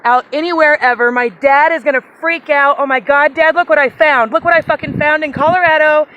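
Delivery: loud, thrilled yelling at the find; excited shouting. Real, not acted. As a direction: shout it out, overjoyed. Finding an arrowhead, shouting excitedly.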